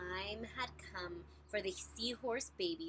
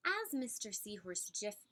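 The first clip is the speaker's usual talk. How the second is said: plain reading voice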